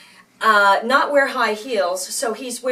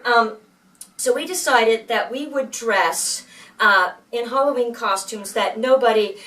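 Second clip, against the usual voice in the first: ordinary talk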